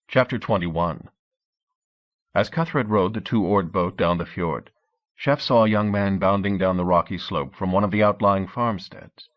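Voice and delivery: ordinary delivery, nothing marked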